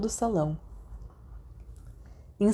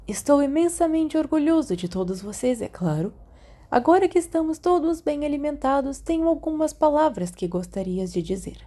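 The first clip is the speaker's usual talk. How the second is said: put-on a higher, proud old headmaster addressing the hall; headmaster's speech; 7 semitones higher than usual. A narrator's reading. Dumbledore addressing the students at the feast, proud and higher. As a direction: speak to the whole hall like a beaming headmaster.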